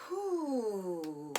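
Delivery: strained whew; effortful exhale. Real, not acted. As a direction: blow out hard from effort. Exhale during exercise.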